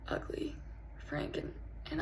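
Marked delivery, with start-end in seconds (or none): 0.1-0.5 "ugly" barely whispered; small, ashamed "ugly"; hushed confession. Acted. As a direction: let "ugly" slip out very softly. First, confessional take of ugly.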